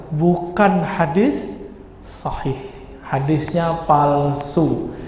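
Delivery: slow and weighty, words spaced out; a slow, firm ruling on the hadith; slow, grave verdict. Real, not acted. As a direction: lay down the verdict slowly. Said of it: "slow emphatic verdict".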